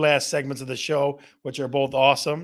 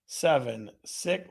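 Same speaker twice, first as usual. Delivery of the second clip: ordinary talk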